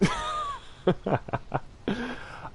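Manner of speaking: delighted laugh. Real, not acted. Laughs about Keke Palmer.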